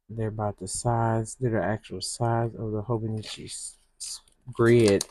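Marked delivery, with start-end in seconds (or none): none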